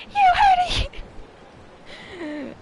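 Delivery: very high, loud screeching cry; hammed-up shriek of triumph; screamed proclamation. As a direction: scream it with every fiber of your being. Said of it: "with every fiber of my being".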